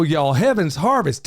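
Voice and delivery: usual speaking voice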